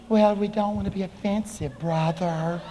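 soft, mocking pious voice; mealy-mouthed believer. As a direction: mimic a timid churchgoer, softly. Mocks timid, inoffensive church people.

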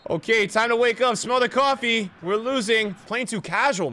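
rally yourself loudly while losing; urgent self pep talk. Real, not acted.

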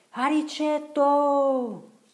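long, very high and loud hail, drawn right out; calling out across water. Acted. Marcello calling to Riccetto swimming far away, long shout. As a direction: call to someone far off on the river. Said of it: "con quanto fiato aveva in gola".

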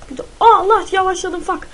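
high, loud yelp of dismay; panicked yelp. Real, not acted. Gamer yelping as he is slowed. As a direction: yelp when your character slows.

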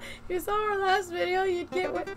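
normal talking voice

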